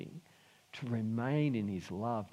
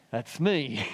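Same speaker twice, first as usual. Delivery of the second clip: usual speaking voice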